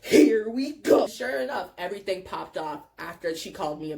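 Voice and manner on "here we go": low groan building to louder drawl; resigned dread. Retold or imitated. Front-seat friend expecting a blowup, drawn-out resigned groan. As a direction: brace for trouble, slowly. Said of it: "already knowing what's about to happen".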